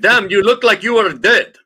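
put-on loud, hammy Russian accent. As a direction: play it as a Russian guy, big and broad. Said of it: "Russian accent actors in the house".

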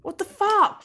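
high, loud shocked outburst; alarmed. Real, not acted. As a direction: blurt it out in fright. Friend left door open, alarmed.

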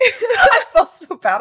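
slow, high laughing words. Real, not acted. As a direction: laugh through it. Laughing at own story.

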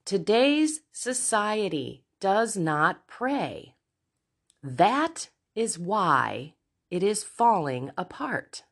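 slow, weighted words with pauses between; deliberate repeated quote. Real, not acted. Podcaster repeating a quote to let it sink in, slow and deliberate. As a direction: repeat it slowly so it sinks in.

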